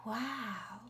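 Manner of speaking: ordinary talk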